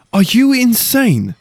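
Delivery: high, loud, slow incredulous cry; can't believe what he hears; alarmed disbelieving shout; shocked outburst. Acted. Dalen shocked at proposal.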